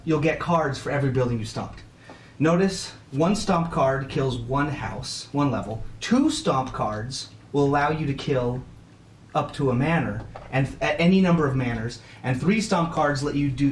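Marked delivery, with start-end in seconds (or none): none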